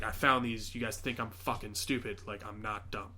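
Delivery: low-key, serious retold confrontation; imitated stern seriousness; really serious. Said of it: "he gets really serious".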